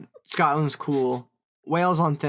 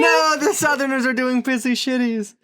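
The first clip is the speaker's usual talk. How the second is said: loud, higher shocked laughing protest; shocked outcry; 12 semitones higher, 6 dB louder, slower than usual. Real, not acted. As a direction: burst out in disbelief. Shocked reaction to pissy shitties.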